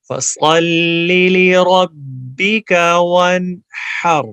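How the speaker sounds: slow, loud chanted recitation; Quran recitation. Shown as a demonstration. Teacher recites the verse slowly for students to repeat. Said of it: "میرے پیچھے پڑھیے آپ".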